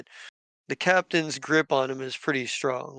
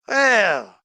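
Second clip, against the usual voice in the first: raised pitch and louder, a drawn-out pirate captain's Well; the captain calls out across the room, louder; 6 semitones higher, 5 dB louder than usual. Acted. The pirate captain addresses the room, raised and louder.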